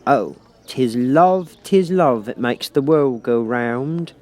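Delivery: drawn-out sermon on love; moralising Duchess. A narrator's reading.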